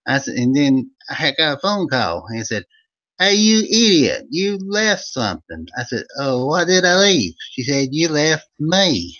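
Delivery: high, loud hillbilly storyteller from Eastern Kentucky; Old Vern character voice. Acted. Host greeting his viewers as Old Vern, hammed-up high folksy voice. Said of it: "it's the Old Vern Show".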